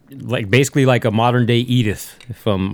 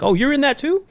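high-pitched mock surprise, rising at the end; surprised. Reacting to another actor popping up in the show, mock surprised. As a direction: let the question shoot up in disbelief.

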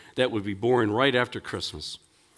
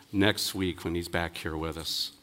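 ordinary talk

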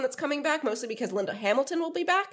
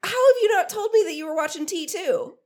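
indignant; 6 semitones higher, 5 dB louder than usual. Real, not acted. Mock outrage at a friend.

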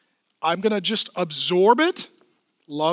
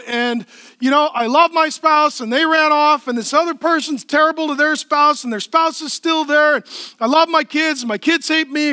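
higher and louder, a complaining churchgoer venting; frustrated believer; 8 semitones higher, 9 dB louder than usual. Retold or imitated. Churchgoer venting to God about unfair life, frustrated whine. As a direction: whine out every grievance in a rush of frustration. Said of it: "You're like".

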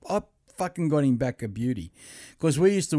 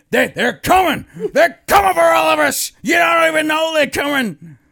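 loud, high-pitched conspiracy tirade; Alex Jones rant; 11 semitones higher, 8 dB louder than usual. Acted. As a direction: shout a paranoid warning to the world. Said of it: "I felt like Alex Jones this morning".

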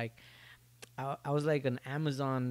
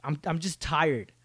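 ordinary delivery

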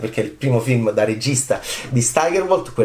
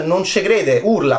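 usual speaking voice

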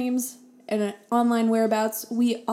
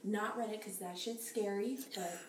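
ad read; 11 dB quieter than usual. Acted. Sponsor read.

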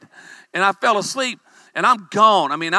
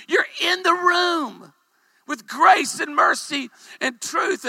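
much higher, rising to a shout; urgent wake-up call; 9 semitones higher than usual. Acted. Preacher calling to the dozing Eutychus, much higher and urgent. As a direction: shake him awake with words.

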